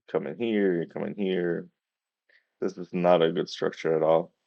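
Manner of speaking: ordinary talk, nothing marked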